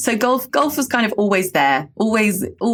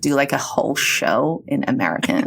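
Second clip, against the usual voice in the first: put-on American English accent; 3 semitones lower than usual. Shown as a demonstration. Briton shows off her attempt, put-on American accent. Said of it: "like, my American accent?".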